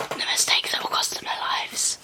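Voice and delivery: a dark, breathy whispered threat; ominous whisper. Acted. Whispering a mock threat to other Kiras. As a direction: whisper it like a curse.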